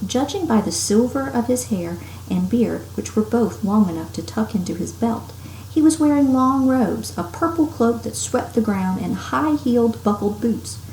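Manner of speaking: ordinary delivery, nothing marked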